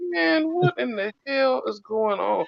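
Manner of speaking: high, soft, slow disbelief; retold bewilderment. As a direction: wonder aloud, drawn out and high. Retelling the friends' bewilderment.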